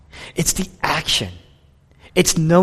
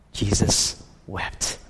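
very slow, softer hushed whisper; 8 semitones lower, 6 dB quieter, much slower than usual. Acted. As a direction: let it hang, barely breathed. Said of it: "The shortest verse".

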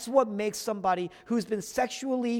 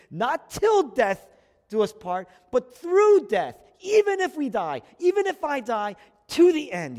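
rising vow; 6 semitones higher, 7 dB louder than usual. Acted. Preacher voicing Jesus' vow to his people, loud and climbing. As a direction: build the vow to a ringing climax.

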